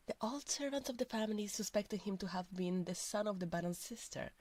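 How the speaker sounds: normal talking voice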